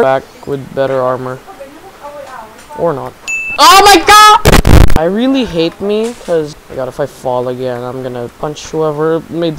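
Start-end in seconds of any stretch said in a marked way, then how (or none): 3.6-5.0 long high drawn-out groan; exasperated yell. Real, not acted. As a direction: yell in slow disbelief. Falls again.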